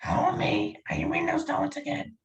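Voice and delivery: high-pitched cartoon wife impression; Marge Simpson. Impressionist quizzing a stranger, high Marge Simpson impression. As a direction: nag your husband in a high cartoon voice. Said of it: "see if you can do Marge".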